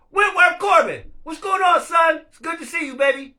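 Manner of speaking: shouting a welcome, pitch way up; loud happy greeting. Real, not acted. Host shouting a welcome to a friend joining the stream. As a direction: hail a friend who just joined, loudly.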